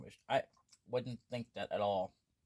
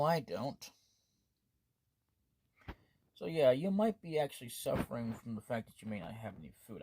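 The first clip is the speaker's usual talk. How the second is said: usual speaking voice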